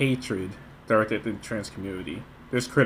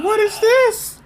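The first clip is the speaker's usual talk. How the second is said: very high, loud disbelieving squeal; incredulous outburst; 6 dB louder, much slower than usual. Real, not acted. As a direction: shriek it in exaggerated disbelief. Reacts incredulously to the clip.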